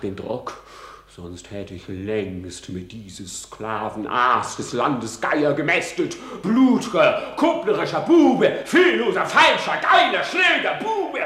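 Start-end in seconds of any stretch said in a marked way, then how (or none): 6.4-11.2 climbing higher and louder with each curse; raging insults. Acted. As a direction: spit every insult out in rising rage. Hamlet cursing the king. (sich steigernd wütend beschimpfend)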